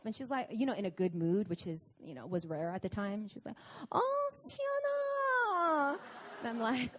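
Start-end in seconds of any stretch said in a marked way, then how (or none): 3.9-6.0 a very high, drawn-out, cheerful mother's call; mother greeting her daughter, sweetly sing-song; sing-song mom. Retold or imitated. Mother in a good mood.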